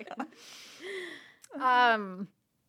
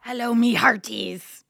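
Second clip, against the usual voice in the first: pirate voice; 9 dB louder than usual. Pretend. Pirate greeting on Talk Like a Pirate Day.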